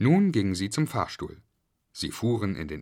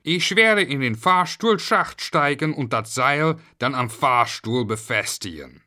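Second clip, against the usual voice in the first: lightly voiced dialect caretaker, louder at first; janitor; 4 semitones higher, 5 dB louder than usual. A narrator's reading. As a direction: announce the plan as a handyman. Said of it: "Der Hausmeister erklärte".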